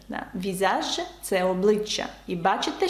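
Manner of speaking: usual speaking voice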